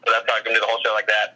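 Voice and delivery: normal talking voice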